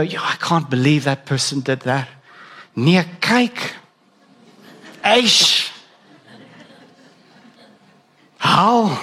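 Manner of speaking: South African gasps of disbelief, Nee kyk and Eish; incredulous newspaper reader; mock shocked reader. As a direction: imitate someone tutting over a scandal.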